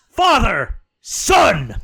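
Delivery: loud, high back-and-forth yells; joyful shouts. Retold or imitated. Father and son calling out, loud and gleeful. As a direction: bellow both names gleefully. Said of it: "the other one is".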